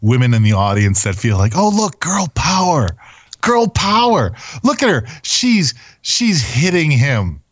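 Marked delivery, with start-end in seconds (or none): 1.6-7.4 high mocking girly cheer. Mocking the audience, high squealing cheer. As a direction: squeal it like a gushing fan.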